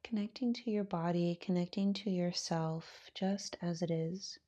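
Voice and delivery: calm, unhurried voice with long pauses; guided meditation. Acted. Yoga teacher settling students into their breath, guided meditation. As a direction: ease them gently into stillness, softening as you go.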